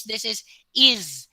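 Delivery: "is" said slowly with a buzzing z at the end; slow, deliberate "is" ending in z; Z sound in is. Shown as a demonstration. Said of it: "That is a Z sound".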